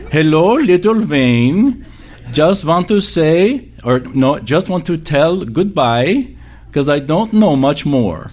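put-on high, slow, old Russian grandmother voice; slow high-pitched Ukrainian granny accent, tender; grandmother's Russian accent. Preacher imitates his great-grandmother's Russian accent from an old tape. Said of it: "I can sound just like her".

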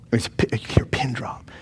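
hushed whisper for the silence. Acted. Preacher whispers to the congregation how silent the room was. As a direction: drop to a whisper, pin-drop quiet.

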